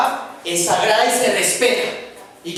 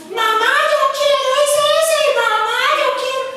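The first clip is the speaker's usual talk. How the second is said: put-on high, whiny little kid begging mom; whining child; 4 dB louder than usual. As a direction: beg like a spoiled little child. Preacher acts whining child.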